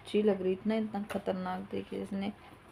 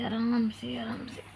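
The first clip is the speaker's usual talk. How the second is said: whispered caution; slower than usual. Retold or imitated. Mother recalling her thought at the door, whispering. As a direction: whisper it to yourself, carefully.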